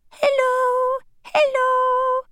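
a tiny voice straining to be heard. Acted. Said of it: "called the voice a bit more loudly".

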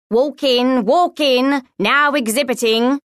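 loud, high showman's patter; booming. A narrator's reading. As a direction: boom it out to draw in the crowd. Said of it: "he boomed".

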